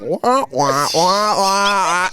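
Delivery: imitated wordless muffled grown-up talk; wah-wah imitation of garbled speech; Charlie Brown adult. Friend's mom talking to a tripping kid, cartoon wah-wah. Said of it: "sounds like Charlie Brown".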